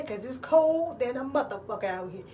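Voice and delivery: usual speaking voice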